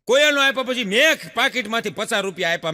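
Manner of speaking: usual speaking voice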